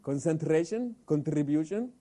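trilled R in "Concentration" and "contribution"; rolled R. Shown as a demonstration. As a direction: roll the R after the T in "Concentration, contribution". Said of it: "Okay, you roll the R".

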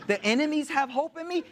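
high-pitched, appalled disbelief; retold indignant astonishment; incredulous repeat.

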